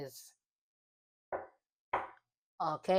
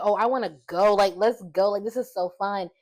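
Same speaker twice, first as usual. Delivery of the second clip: eager excitement; 9 dB louder than usual. Retold or imitated. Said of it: "I was like".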